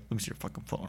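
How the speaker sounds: hushed threatening demand; menacing whisper. Retold or imitated. Demanding a stranger's phone, menacing whisper. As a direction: hiss the threat. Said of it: "It's like".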